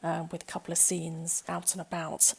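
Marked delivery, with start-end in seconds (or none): none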